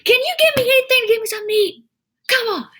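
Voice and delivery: mock a man's strained, high holler; retold yelling. Man on a bench demanding food from a passerby, yelling. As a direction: imitate his high-pitched yell. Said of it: "then he yells at me".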